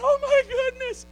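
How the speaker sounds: high, loud astonished exclamation; amazed gasp. Acted. People seeing quail beyond measure, loud amazed cry. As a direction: gasp it out like they can't believe it.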